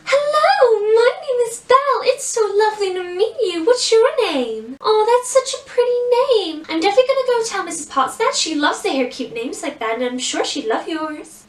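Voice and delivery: put-on high, dainty, girly princess with an American accent; sugary American princess meet-and-greet, opening high; sweet princess Belle. Shown as a demonstration. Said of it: "really high pitch and really, really sweet".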